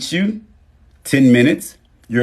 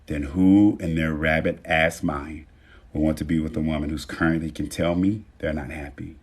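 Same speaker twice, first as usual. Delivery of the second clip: usual speaking voice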